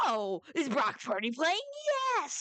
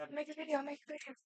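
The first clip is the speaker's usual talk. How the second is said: quiet urgent hush to dad; hushed aside; 3 semitones higher, 9 dB quieter, much faster than usual. Real, not acted. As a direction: drop the voice to nearly nothing. Dad walks in mid-recording.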